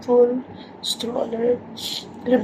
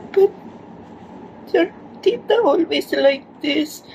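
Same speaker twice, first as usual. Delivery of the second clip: normal talking voice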